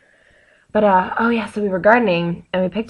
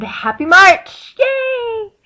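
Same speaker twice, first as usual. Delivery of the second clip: excited cheer; 8 dB louder, much slower than usual. Real, not acted. Greeting March cheerfully.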